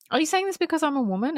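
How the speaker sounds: quick, high-pitched offended question; imitating an indignant comeback; retold challenge. Acted challenge in retold argument.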